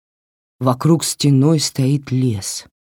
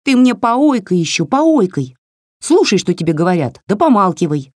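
higher, snappy little doll telling her off; scolding doll voice; 5 semitones higher, 3 dB louder than usual. Acted. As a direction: scold like a cheeky tiny doll. Doll mocks Varya's ойкать. (высокий бранчливый голосок куклы)

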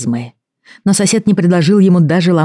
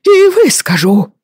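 put-on high, outraged old man, slowing; furious pompous apothecary; indignant old man voice; 4 dB louder, slower than usual. Apothecary storms off.

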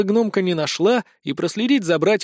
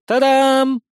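excited exclamation; 7 semitones higher, 4 dB louder than usual. Acted. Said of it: "воскликнула она".

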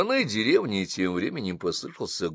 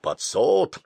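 grumbling, lower; 5 semitones higher than usual. Acted. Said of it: "ворчал Басилов".

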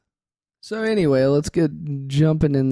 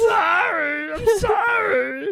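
high, slow whiny dinosaur; Carl the dinosaur; much slower than usual. Pretend. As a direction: apologise like a clumsy dumb dinosaur. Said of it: "it's Carl, man".

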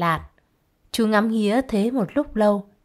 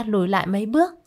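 plain reading voice

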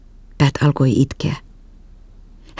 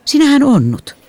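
higher, gentle nurse voice; nurse's voice; 11 semitones higher than usual. A narrator's reading. Nurse tells Jessica she is limping, higher voice. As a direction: voice the kindly nurse.

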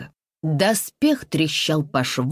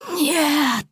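much higher desperate scream; 11 semitones higher than usual. Acted. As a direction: scream it in horror. Mother sees son attacked.

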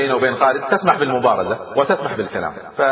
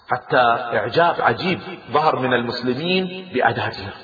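plain reading voice

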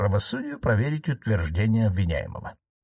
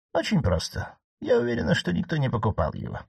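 old marquise, voiced higher, lightly ironic; 8 semitones higher than usual. Aunt teasing her nephew the judge, voiced higher. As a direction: needle the judge in a higher aunt's voice.

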